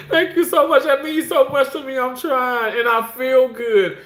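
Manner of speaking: high-pitched, gushing gratitude; heartfelt excited thanks. Real, not acted. Host thanking a viewer for praise, excited. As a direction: gush your thanks in a high voice.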